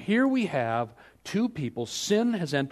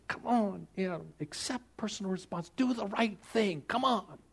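soft, high, imploring urging; pleading; 5 semitones higher, 7 dB quieter than usual. Acted. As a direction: plead under your breath, stretching the last words. God pleading with Adam, acted.

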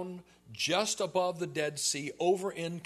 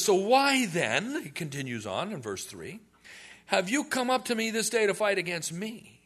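mock loud challenge; 6 semitones higher, 5 dB louder than usual. Jephthah demanding of Ephraim why they came to fight, loud. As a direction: throw the question back at them.

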